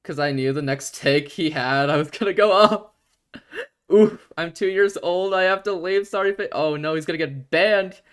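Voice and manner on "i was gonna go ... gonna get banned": laughing excitedly. Real, not acted. Laughing at chat joke.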